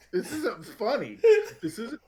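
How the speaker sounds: high, loud, cracking up while protesting; helpless real laughter. Real, not acted. Player scolding themself over their own joke, laughing helplessly. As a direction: protest through uncontrollable laughter. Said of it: "I can't stop laughing".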